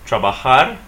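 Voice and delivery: "Trabajar" as tra-ba-HAR; Spanish "Trabajar", weight at the very end; stress on last syllable. Shown as a demonstration. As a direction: push the final "jar" in "Trabajar". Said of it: "we're stressing the last syllable".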